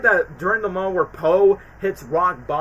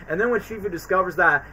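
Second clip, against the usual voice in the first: ordinary delivery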